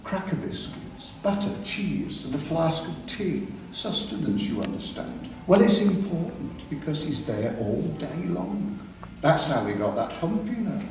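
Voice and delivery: soft, half-whispered gossip as Dolly; softly spoken Dolly. Retold or imitated. Dolly confiding about Jasper, softly spoken. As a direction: murmur it like a camp gossip. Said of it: "said softly spoken Dolly".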